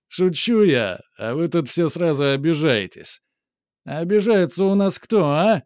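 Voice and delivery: loud, high mocking thug; jeering, half-joking menace; street thug. A narrator's reading.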